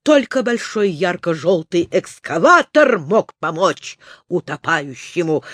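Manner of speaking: ordinary delivery, nothing marked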